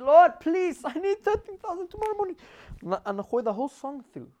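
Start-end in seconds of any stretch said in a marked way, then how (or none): 0.0-2.4 higher, pleading cry to the Lord; tearful high plea for money; crying prayer. Retold or imitated. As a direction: plead desperately, voice pitched up in tears. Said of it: "I'm crying".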